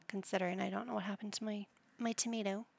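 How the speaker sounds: ordinary talk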